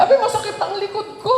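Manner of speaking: high, fast whiny complaint; high, quick voice of a nun dodging work; whiny excuse. Retold or imitated. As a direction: plead a sore back in a put-on whine. Nun complains to avoid chores.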